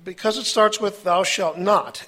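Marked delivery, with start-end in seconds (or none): 1.0-2.1 slow, louder "thou shalt not", landing hard on "not"; drawn-out, emphatic "not" ending the phrase; stress on NOT. Shown as a demonstration. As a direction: hit the word "not" firmly. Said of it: "emphasis on the word not".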